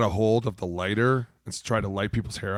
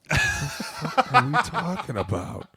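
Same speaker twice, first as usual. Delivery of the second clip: baffled; 7 semitones higher, slower than usual. Retold or imitated. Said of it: "And I'm like".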